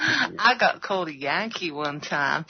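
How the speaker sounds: slow mimicked Southern drawl; imitated Georgia accent. Australian repeating the copied line, slow mock Georgia accent. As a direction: draw it out like a Georgia native. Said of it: "can I just copy that?".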